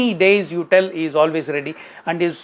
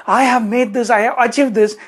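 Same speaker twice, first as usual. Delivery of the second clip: excited employee; 6 semitones higher, 4 dB louder than usual. Retold or imitated. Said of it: "excited in sharing that".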